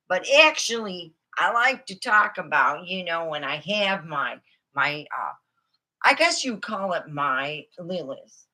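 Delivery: ordinary delivery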